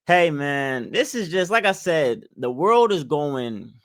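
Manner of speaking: usual speaking voice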